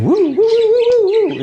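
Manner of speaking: high, loud whooping siren wail; police siren. Retold or imitated. Storyteller imitating the cop car's siren, high loud whoops.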